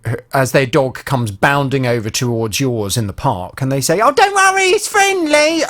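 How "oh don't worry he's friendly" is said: high-pitched singsong mimicry of a carefree owner; oblivious dog owner. Owner calling across the park as their dog bounds over, blithely reassuring. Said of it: "so many people like that who".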